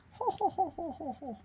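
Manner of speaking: high, hammed-up Santa-like ho ho ho, fading; mock belly laugh, starting high; jolly ho ho laugh. Host laughs at her own painting joke with a mock Santa ho ho ho.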